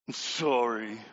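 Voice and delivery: low, sulky mumbled sorry; grudging kid apology. Retold or imitated. Tommy giving his sister a grudging sulky apology. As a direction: mutter it like a sulking child. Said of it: "and then you get this".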